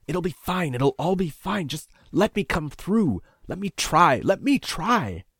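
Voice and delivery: high, slowing to a drawn-out beg; insistent begging to be let through; desperate pleading. A narrator's reading. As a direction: plead harder with each repeat. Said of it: "he pleaded".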